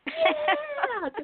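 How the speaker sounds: high whooping yeah; rock yell. Retold or imitated. Patient cheering her tumor goodbye, high whoop.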